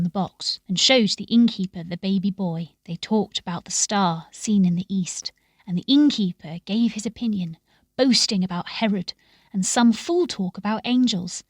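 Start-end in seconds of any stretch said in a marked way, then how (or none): none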